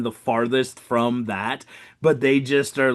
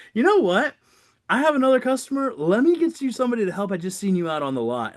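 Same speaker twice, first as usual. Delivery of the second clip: bright and rushed; 10 semitones higher, faster than usual. Retold or imitated. Cheerfully handing off a customer.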